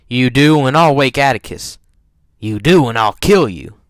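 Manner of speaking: loud, forceful threat; threatening. A narrator's reading. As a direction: warn them off loudly. Scout threatens her brother.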